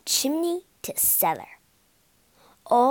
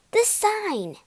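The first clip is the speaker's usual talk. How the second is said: higher excited cry; 9 semitones higher, 3 dB louder than usual. A narrator's reading. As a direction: cry out in discovery. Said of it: "she cried".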